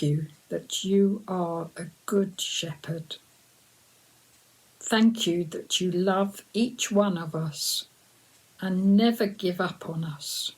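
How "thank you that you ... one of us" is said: usual speaking voice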